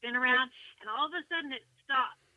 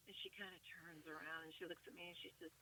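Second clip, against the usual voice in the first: normal talking voice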